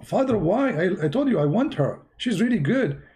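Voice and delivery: high, bewildered son's voice; pleading son. Retold or imitated. Son protests to his father.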